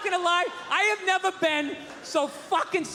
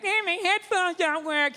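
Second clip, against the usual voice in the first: high, slow whiny passenger; drawn-out mock whine, pitched up; mock whining; 5 semitones higher than usual. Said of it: "Bunch of people whining in the back".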